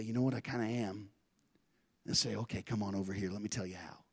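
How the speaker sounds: almost confide it under your breath; hushed confession. Acted. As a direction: someone quietly admitting they are tired of the game. Hushed quoted convert exchange.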